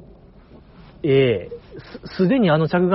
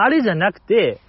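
ordinary talk

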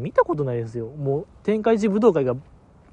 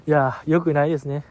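ordinary talk

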